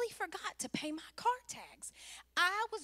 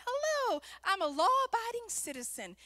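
high, louder mock-innocent hello; sweetly law-abiding, pitched up; cheery acted greeting; 7 semitones higher, 4 dB louder than usual. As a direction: greet the officer like a model citizen. Acts out greeting the police.

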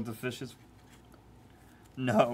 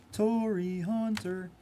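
drawn-out high call; 10 semitones higher, much slower than usual. Acted.

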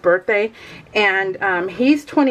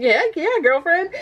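excited; 7 semitones higher than usual. Retold or imitated. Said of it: "I'm like".

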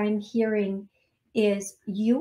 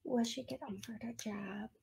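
whisper; 17 dB quieter than usual. Real, not acted. Asks the spirits privately, aside.

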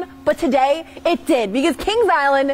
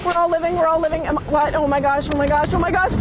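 ordinary delivery

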